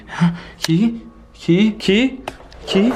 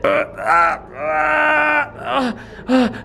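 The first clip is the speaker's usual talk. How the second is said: drawn-out effortful grunts; straining groans; 2 semitones higher, much slower than usual. Acted. Hand jammed reaching for a key, pained straining groans. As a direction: groan as you force your hand in.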